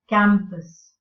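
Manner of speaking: campus with CAM loud and slow; CAM-pus, second syllable light; first-syllable stress. Shown as a demonstration. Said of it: "the first syllable is stressed".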